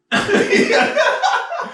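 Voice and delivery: very high squealing laugh. Real, not acted. Laughing at the story, very high squealing laugh.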